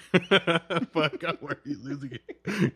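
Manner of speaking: breaking into laughter mid-quote; laughing. Real, not acted. Retold quote cracked up by laughter.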